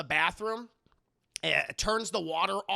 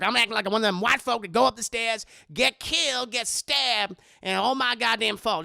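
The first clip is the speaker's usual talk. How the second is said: loud, worked-up self-scolding; exasperated grumbling; 3 semitones higher, 6 dB louder than usual. Acted. Madea heading up the stairs, loud exasperated ranting. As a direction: rant it loudly at yourself. Acting Madea griping in attic.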